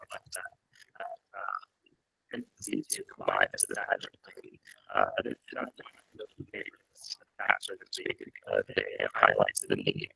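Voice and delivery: muffled, cutting-out call audio; underwater. Real, not acted. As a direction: voice swallowed by noise suppression. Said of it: "it just sounds like you're underwater".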